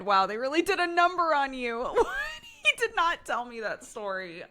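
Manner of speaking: high, loud reading breaking into laughter; laughing disbelief. Real, not acted. Reacting to a viewer's story about Sam, laughing in disbelief. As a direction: crack up as the story lands, pitched high. Reads anecdote, cracks up in disbelief.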